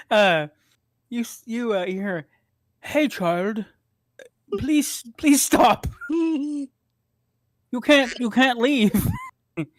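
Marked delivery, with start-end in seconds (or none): 2.8-9.1 high, slow, halting plea; pleading stranger's voice. Acted. Stranger begs the fleeing child to stay, high slow voice. Voice behind the runaway at the gate.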